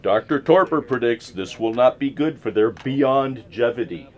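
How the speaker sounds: usual speaking voice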